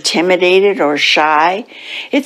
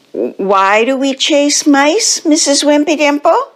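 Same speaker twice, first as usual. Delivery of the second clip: puzzled pupil question; 7 semitones higher than usual. Acted. Splat questioning his teacher, high and puzzled. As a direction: wonder aloud in a higher voice.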